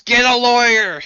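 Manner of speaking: loud, high-pitched shout; distant yell. Acted. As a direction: yell it out at full volume. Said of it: "somewhere from another universe yells".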